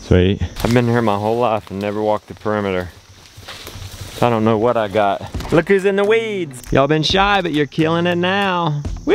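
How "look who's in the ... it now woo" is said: high, bright encouraging call; cheering on animals. Real, not acted.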